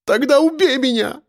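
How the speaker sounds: high-pitched desperate cry; screaming. A narrator's reading. Captive begs captor to kill her, screaming. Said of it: "вскрикнула она".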